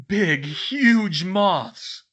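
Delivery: very slow, loud, high horror report; rattled exclamation. A narrator's reading. Fred describes the giant moths, loud and rattled. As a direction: stretch each word out, loud and high.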